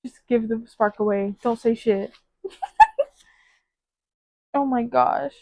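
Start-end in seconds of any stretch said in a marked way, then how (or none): 0.0-2.1 1 mock annoyed aside. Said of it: "I was like". 2.4-3.0 laughs in a high squeal; squeaky burst of laughter; high giggle. Real, not acted. Laughing at own joke.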